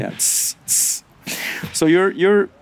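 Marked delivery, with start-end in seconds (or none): 0.2-1.0 steam hiss. Retold or imitated. Imitating sauna steam hiss.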